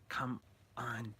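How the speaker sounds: whispered coaxing to your own brain; hushed, pleading with yourself; whispered plea. Pretend. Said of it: "And you're like".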